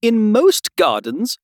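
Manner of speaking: high, slow flower character; know-it-all flower explaining; lecturing Tiger Lily. A narrator's reading.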